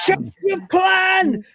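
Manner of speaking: high, loud panicked yell; panicked shout. Retold or imitated. Alien shouting at fleeing Lister, loud panicked yell. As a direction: bellow the retreat in panic.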